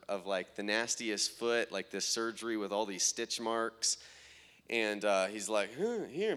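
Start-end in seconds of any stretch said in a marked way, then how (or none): 5.8-6.3 high, unintelligible little mumble in imitation; imitated foreign speaker. Man showing his foot to the preacher, imitated foreign speaker. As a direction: mimic someone you can't understand, pitched up. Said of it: "but I don't understand him".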